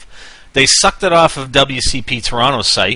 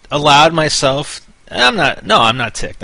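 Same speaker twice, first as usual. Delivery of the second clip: ordinary delivery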